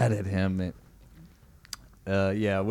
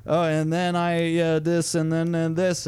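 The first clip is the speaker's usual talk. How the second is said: higher, slow, evenly droned read-aloud; reading straight off script; 6 semitones higher, slower than usual. Retold or imitated. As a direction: imitate a non-actor reading word by word. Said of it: "just reading straight from it".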